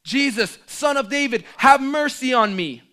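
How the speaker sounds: high, loud desperate cry. Retold or imitated. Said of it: "he cried out all the more".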